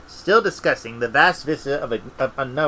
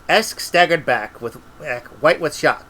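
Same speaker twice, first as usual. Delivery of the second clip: usual speaking voice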